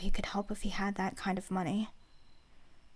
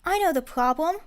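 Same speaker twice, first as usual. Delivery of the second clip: put-on eager child voice; 6 semitones higher, 6 dB louder than usual.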